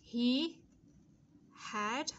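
"He", a long pause, then "had"; halting, spaced-out model reading; slow dictation. Shown as a demonstration. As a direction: dictate word by word, leaving time to write. Dictating the sentence for writing.

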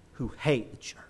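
sudden hushed whisper; breathy aside as if warning the congregation. Acted. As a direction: drop to a secret whisper about them. Preacher hushes on hateful zealots.

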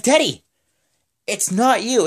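high-pitched exasperated boss; loud, hammed-up correction; Vince. Boss turning Teddy away, mock exasperation. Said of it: "he's like".

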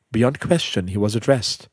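ordinary delivery

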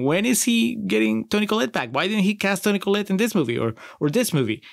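high, pleading, fast complaint; exasperated plea. Retold or imitated. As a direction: beg the director like a frustrated fan. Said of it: "I've been saying".

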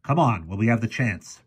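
high, urgent warning easing down; panicky. A narrator's reading. Professor urges everyone to flee, panicky. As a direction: plead urgently to get moving. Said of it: "in a panicky voice".

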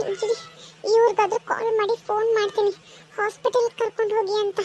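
a pregnant woman gripped by stomach pain. Acted. (ನೋವಿನ ಧ್ವನಿ)

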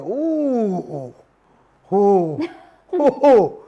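loud, rising hype whoops; wowed "uh" cries building to a peak; excited whoop. Retold or imitated. Reliving reaction to the video.

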